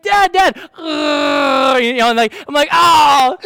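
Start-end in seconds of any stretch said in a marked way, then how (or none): 0.0-0.6 high, loud imitation of an excited child; excited little boy. Five-year-old son running down the hall to his dad, mock excited. 0.8-1.8 loud, high creature bellow; mock Chewbacca roar. Son shows his dad the Star Wars creature, imitated roar. 2.7-3.4 loud, high gushing cry; hammed-up doting dad's exclamation; exaggerated delighted "oh". Dad reacts to his son's cute roar, hammed-up delight.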